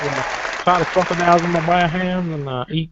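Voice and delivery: hammed-up slow Southern swamp yokel; very bad Cajun-style Southern accent; deliberately bad Southern accent. Shown as a demonstration. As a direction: lay on a terrible Southern drawl. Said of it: "It's a deliberately bad accent".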